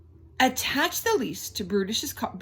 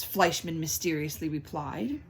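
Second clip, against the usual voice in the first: usual speaking voice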